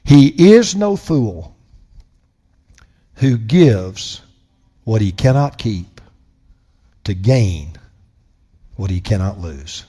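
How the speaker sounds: slow, soft, each phrase left hanging; very slowly. Shown as a demonstration. Preacher reading a quote slowly to his congregation, soft and slow. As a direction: read it slowly so every word sinks in. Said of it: "I'm gonna read it very slowly".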